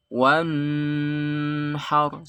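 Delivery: Wanhar with the n held far too long; wrong drawn-out n. Shown as a demonstration. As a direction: drag out the n in Wanhar.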